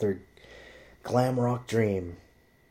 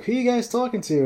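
curious call; 4 dB louder than usual. Retold or imitated.

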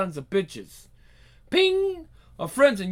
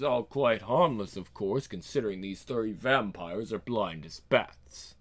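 low, soft, unhurried menace; hushed, deep-pitched villain voice; smooth villain; 9 semitones lower, 7 dB quieter than usual. Acted. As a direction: gloat quietly over helpless enemies. Villain calmly gloating.

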